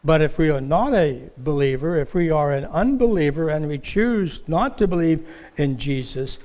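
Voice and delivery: ordinary talk, nothing marked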